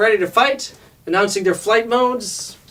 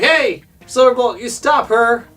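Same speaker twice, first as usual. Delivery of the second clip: higher, louder mock command; retold called-out order; 7 semitones higher, 4 dB louder than usual. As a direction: call out to stop her. Optimus calling out an order.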